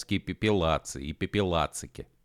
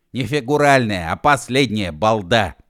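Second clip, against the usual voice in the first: loud, raised-pitch correction; angry shout; 3 semitones higher, 8 dB louder than usual. A narrator's reading. Uef corrects Gedevan about last breath, angry shout. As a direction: shout down the fool. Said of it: "закричал У Ф".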